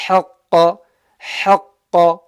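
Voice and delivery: HAQQA with air pushed out on the ha; airy throat ha. Shown as a demonstration. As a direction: push out the ha of HAQQA from mid-throat, loudly. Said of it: "get that air out".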